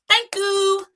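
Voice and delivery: very high little-child imitation; mimicked tot's high-pitched thanks; happy toddler. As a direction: pipe it like a pleased toddler. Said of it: "start clapping his hands and say".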